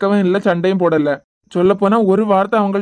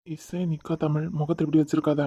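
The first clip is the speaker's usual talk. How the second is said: put-on much softer, kept low so the child won't hear; hushed aside; 4 semitones lower, 9 dB quieter than usual. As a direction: drop the voice to a hush. (மகள் கேட்காதபடி மெதுவாக)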